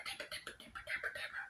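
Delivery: hushed, voiceless crowd mutter; whispered murmuring. Acted. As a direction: whisper the name over and over. Everybody murmurs his name.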